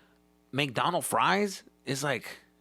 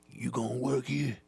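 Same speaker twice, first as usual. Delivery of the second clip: put-on old-timer. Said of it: "He's just an old timer".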